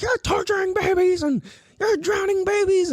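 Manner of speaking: very high mock screech; hammed-up hysterical accusing; people freaking out. Mimicking onlookers accusing the swim teacher, hysterical screech. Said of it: "had people freak out on me".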